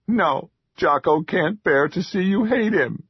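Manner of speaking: high anguished troll plea; upset troll. A narrator's reading. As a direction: wail it like a wounded little creature. Ashamed troll hiding.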